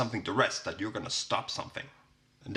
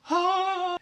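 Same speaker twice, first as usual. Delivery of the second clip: mock satisfied ah; 5 dB louder than usual. Satisfied tea sip.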